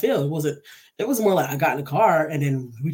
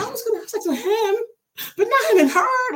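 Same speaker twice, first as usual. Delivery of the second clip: high-pitched wail, louder at the end; imitated crying; 4 dB louder than usual. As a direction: act out sobbing indignantly to your sister. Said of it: "I'm crying like a little bitch".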